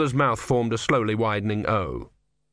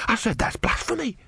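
higher, repeating it firmly; insistent novice; 12 semitones higher than usual. A narrator's reading. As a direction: say it again, scandalized.

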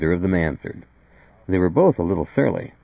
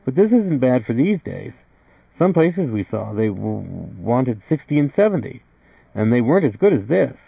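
higher-pitched husband, hesitant on a word; young husband; 6 semitones higher, 3 dB louder than usual. A narrator's reading. Art defending the rent to a visitor, higher-pitched and earnest.

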